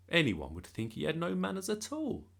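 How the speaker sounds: plain reading voice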